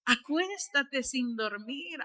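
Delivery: soft, lulling persuasion; soothing tempter. Acted. Devil luring a tired believer away from prayer, soft coaxing. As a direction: coax a tired person gently toward bed. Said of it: "Quiere poner cansancio".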